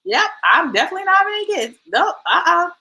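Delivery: high, fast, firm refusal; imitating daughter. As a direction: rattle it off high like a spooked teen. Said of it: "She was like".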